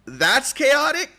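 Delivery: squeaky, very high and loud incredulous question; a cartoonish squeal of disbelief; mock Bombfrost. Friend Bombfrost squeaks back in disbelief, very high and loud. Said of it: "Bombfrost is gonna come and be like".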